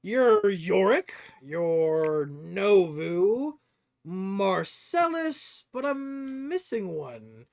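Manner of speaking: high, loud, drawn-out sizing up of the party; inquisitive stranger. Acted. Stranger at the table checks the party roster, inquisitive. Game master plays the stranger.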